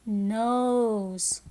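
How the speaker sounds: "Nose" drawn out; slow repeat of "Nose" with a stretched vowel; long O in nose. Shown as a demonstration. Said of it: "words that have the long sound".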